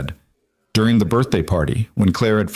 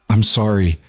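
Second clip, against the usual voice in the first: ordinary delivery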